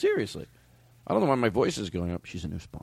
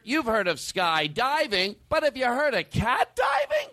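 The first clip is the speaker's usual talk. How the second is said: exaggerated puff-piece reporter, very slow; hammy, drawn-out TV feature voice; Jeannie Mose; 8 semitones higher, slower than usual. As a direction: swoop high on the teaser questions. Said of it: "You know, it's like".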